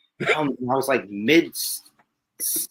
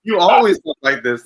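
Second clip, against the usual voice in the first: raised, loud mock retort; louder, higher retold tease; imitating April; 5 semitones higher, 10 dB louder than usual. Guest repeats April's verdict on his mannerisms, mock protest. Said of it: "And April was like".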